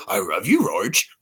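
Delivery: cartoon dog voice with r-heavy words; low talking dog, pitch swooping up; slurred, adoring cartoon pooch; Astro. Shown as a demonstration. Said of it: "it's Astro and, and Scooby are almost the same".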